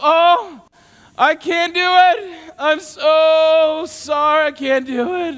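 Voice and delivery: high, loud, slow hammed-up whine; mock whining. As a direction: wail your helplessness like a self-pitying man. Mocking the man's self-pity.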